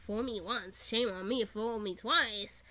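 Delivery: ordinary delivery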